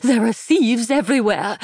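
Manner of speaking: put-on high, panting old lady; breathless panic. A narrator's reading. Fretful mother panting warnings at her daughter Jean. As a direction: gasp it out between pants. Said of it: "managed between pants".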